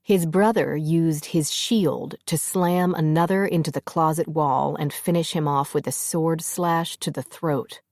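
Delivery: ordinary delivery, nothing marked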